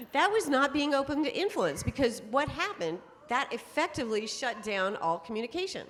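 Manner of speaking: ordinary delivery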